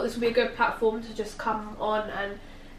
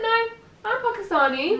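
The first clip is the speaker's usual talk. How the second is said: indignant retort; 12 semitones higher than usual. Retold or imitated. Telling people who doubt her heritage, in a high indignant protest. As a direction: correct them sharply, voice pitched way up. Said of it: "And I'm like".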